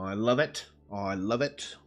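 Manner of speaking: usual speaking voice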